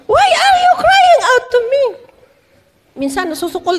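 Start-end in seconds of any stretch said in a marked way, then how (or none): 0.1-1.8 very high, shouted mock question; exasperated rebuke. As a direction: cut off the pleading with an acted, high-pitched yell. Acts God's exasperated rebuke. (pasigaw na kunwaring paninita)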